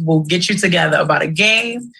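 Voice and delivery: normal talking voice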